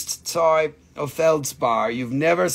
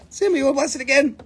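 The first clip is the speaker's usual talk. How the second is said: coaxing the dog; 8 semitones higher, much faster than usual. Real, not acted.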